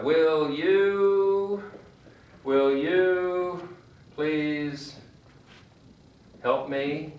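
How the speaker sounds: slow request. Shown as a demonstration. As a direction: ask slowly, word by word. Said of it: "You say, for example".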